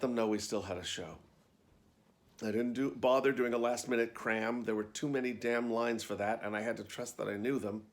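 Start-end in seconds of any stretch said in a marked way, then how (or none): none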